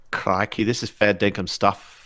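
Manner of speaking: low, hammed-up bad Australian accent. Player joking after the failed Irish, bad low Australian accent. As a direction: badly fake an Australian with Irish creeping in. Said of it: "can't even do a bad Australian accent".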